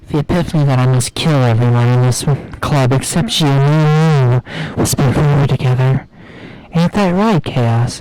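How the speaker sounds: loud creepy villain. Acted. Streamer hams up Monika's murderous plan in a booming sinister voice. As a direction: bellow it like a gleeful evil mastermind.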